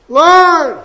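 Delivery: single high call of "Lord". Retold or imitated. Paul calls to the Lord again, high cry. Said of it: "maybe louder, I don't know, maybe softer".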